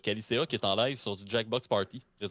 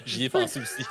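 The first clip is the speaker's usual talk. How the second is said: laughing; 9 dB louder than usual. Real, not acted. Laughing at the caseux name.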